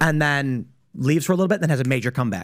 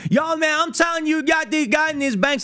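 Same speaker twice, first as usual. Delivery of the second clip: much higher, hyped-up street-talk voice; 13 semitones higher than usual. Retold or imitated. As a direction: switch on a pumped showman persona for the camera. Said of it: "Camera turns on".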